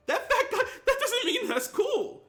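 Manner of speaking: high, slow, laughing disbelief; laughing outburst. Real, not acted. Streamer laughing at his own fat-joke example, high. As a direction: squeal it out through a laugh.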